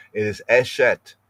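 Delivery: normal talking voice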